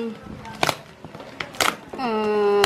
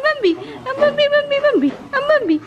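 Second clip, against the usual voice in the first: high, gushing sing-song calls of the name; 13 semitones higher than usual. Real, not acted. Owner gushing over her dog Bambi, excited and high. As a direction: squeal the dog's name over and over.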